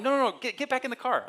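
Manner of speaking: high, loud insistence; firm call back into the car; parents insisting. Retold or imitated. Parents insisting in retold scene.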